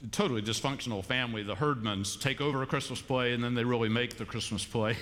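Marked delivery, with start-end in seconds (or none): none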